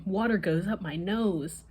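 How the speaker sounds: usual speaking voice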